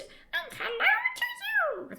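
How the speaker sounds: high, tiny fairy voice for "hello"; tiny creature. Acted. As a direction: squeak it like a little sprite. Restarts line as creature.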